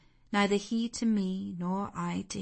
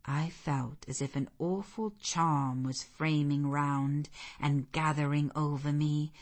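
ordinary delivery

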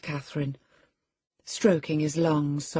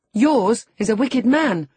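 put-on higher, louder indignant girl; sharp retort; 8 semitones higher, 8 dB louder than usual. A narrator's reading. Young lady retorting about his father, indignant and loud. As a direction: fire back indignantly. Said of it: "retorted Catherine".

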